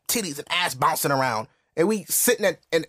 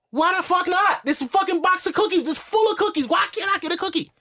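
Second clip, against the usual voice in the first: put-on whining child. Child protests to mother over a cookie. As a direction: throw a kid's tantrum.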